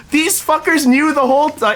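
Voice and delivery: high-pitched incredulous outburst, acted; mock indignation. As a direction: imitate her outraged disbelief in a high voice. Friend's indignant reaction, retold.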